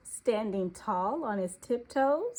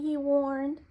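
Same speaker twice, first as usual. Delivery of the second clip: plain reading voice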